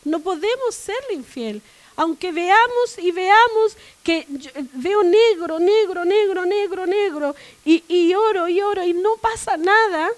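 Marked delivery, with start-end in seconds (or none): 2.0-10.1 loud, driving sermon climax; fiery preaching. Acted. Preacher to the church, loud and unshaken. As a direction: proclaim it with full force to the congregation.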